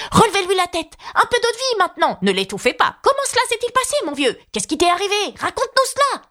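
high, flustered overlapping-crowd voices; voix confuses. A narrator's reading. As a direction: fire off each cry very high, in a fluster. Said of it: "un chœur de voix confuses". (brouhaha de voix aiguës et affolées)

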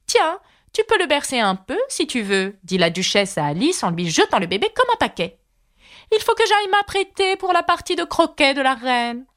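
grand lady tossing off orders; Duchess. Acted. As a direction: hand it off carelessly, in a hurry. Duchess voiced by narrator.

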